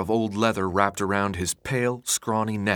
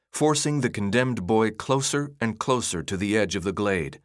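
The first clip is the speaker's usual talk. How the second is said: plain reading voice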